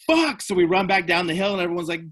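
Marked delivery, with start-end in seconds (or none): 0.1-0.4 much higher, a little louder; alarmed curse. Retold or imitated. Band seeing the engine on fire, alarmed curse. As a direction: blurt it out in alarm.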